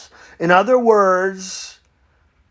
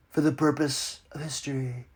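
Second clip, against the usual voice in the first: soft aside; 4 semitones lower, 11 dB quieter than usual. Acted. Aside in letter.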